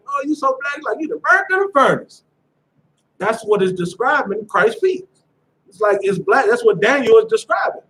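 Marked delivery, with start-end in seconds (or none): 0.1-2.2 high-pitched playful roast; high mock jeer. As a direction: imitate someone clowning on a friend, voice pitched way up. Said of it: "you roasting somebody".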